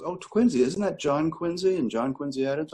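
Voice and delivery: a hint of British, high and questioning; British accent. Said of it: "she was a British accent".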